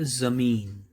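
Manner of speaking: ज़मीन with a buzzed Z at the start; careful teacher's Z in ज़मीन; dotted Z sound. Shown as a demonstration. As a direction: stretch out ज़मीन, opening on Z as in zoo. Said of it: "जो बिंदी लगती है अक्षर के नीचे".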